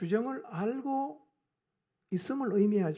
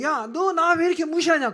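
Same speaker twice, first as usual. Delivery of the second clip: loud, raised acted scolding; God's rebuke; 6 semitones higher, 11 dB louder than usual. God confronting Israel for ignoring him, in loud rebuke. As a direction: confront them loudly, voice pitched up. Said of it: "그래서 하나님이".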